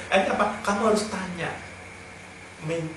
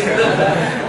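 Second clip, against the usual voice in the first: rising laugh; 4 semitones higher, 5 dB louder than usual. Real, not acted. Laughing at wife's reply.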